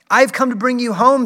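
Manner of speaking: high, loud, eager little sister voice; a small girl bursting with joy to fetch her brother; excited little girl. A narrator's reading. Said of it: "clapping her tiny hands and bending down to laugh".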